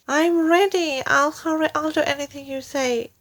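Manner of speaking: high eager frightened cry, quickening; cried. Acted. Wife agrees to anything, high eager cry. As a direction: gush it out high and fast. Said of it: "cried Missus Twit".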